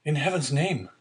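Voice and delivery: high, pleading exclamation; unhappy cry. A narrator's reading. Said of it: "cried the unhappy young man".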